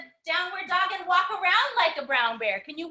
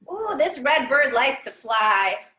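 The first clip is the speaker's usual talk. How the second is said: normal talking voice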